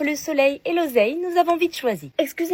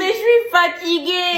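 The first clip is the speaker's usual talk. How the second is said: whiny tired complaint; 5 semitones higher, 5 dB louder, slower than usual. Acted. Exhausted pupil begs to stop.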